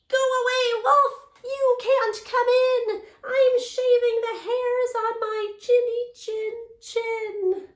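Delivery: high, loud squeaky pig voice; shrill little pig shouting through the door; pig yelling back. Retold or imitated. Second pig yelling at the wolf in a high, loud pig voice. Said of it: "He yelled back".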